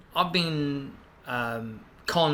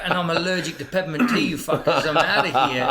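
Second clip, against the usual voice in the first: loud, laughing rant; raised voice breaking into laughter; mock outburst; 7 dB louder than usual. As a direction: storm off in joking exasperation. Mock outburst at pushy sellers, laughing.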